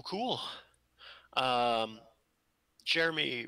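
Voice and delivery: normal talking voice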